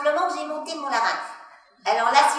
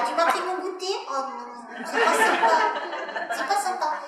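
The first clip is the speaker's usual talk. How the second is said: put-on higher, childlike whiny tone; little child voice; 3 semitones higher than usual. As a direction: speak like a sulky small child. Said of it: "parce que j'ai monté mon larynx".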